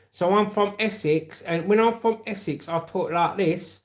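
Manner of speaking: high-pitched Essex accent. Shown as a demonstration. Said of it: "That's Essex".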